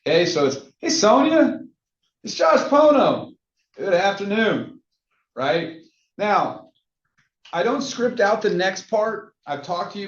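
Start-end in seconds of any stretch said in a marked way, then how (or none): 0.8-4.8 high, upbeat, sing-song phone greeting; energetic sales opener. Shown as a demonstration. Agent opening a cold call, energetic and upbeat. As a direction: nail it with big friendly energy, pitched up. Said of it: "the tone was there, the energy was there".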